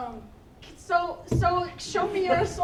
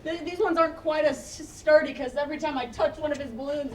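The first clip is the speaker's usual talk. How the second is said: ordinary talk, nothing marked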